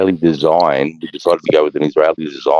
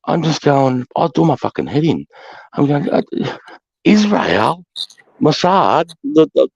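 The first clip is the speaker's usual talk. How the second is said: much higher, strained, exasperated outburst; real frustration; 9 semitones higher than usual. Real, not acted. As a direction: let the frustration burst out. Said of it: "it's so frustrating sometimes".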